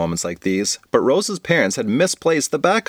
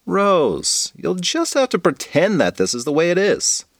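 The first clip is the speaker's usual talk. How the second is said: put-on exasperated kid; 6 semitones higher than usual. Bridget impatient with Rose's objection.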